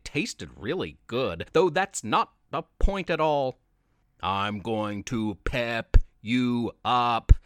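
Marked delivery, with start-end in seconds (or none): 4.2-7.3 slow, low booming monster; drawn-out low monster roar. Acted. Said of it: "it screamed over and over again".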